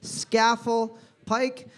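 slow, drawn-out name; slow careful reading. Shown as a demonstration. Quiz host reading a hard place name slowly. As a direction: spell it out with your voice slowly. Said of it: "S C A F E L L".

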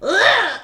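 high squashed "ugh"; imitated strained grunt. Fan acting out the squashed weightlifter, strained grunt. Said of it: "the sound, he goes".

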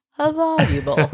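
high, mock-dreamy "How valuable"; mocking imitation. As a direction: imitate him, eyes closed, overdoing it. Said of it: "You were like".

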